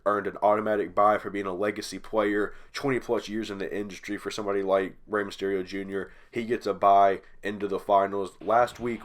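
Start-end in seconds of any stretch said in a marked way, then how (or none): none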